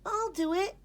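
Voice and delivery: put-on high, eager kid; eager kid calling out; eager cry. A narrator's reading. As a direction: jump at the chance. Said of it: "cried Gregory".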